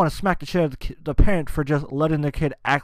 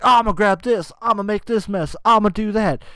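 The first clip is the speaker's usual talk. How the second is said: mock wild kid; 6 semitones higher, 5 dB louder than usual. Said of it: "be like".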